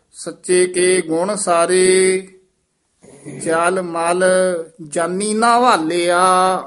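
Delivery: measured, sustained Gurbani recitation; scripture recitation. Shown as a demonstration. Teacher reciting verse for students, sustained recitation. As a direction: hold the line endings long and even.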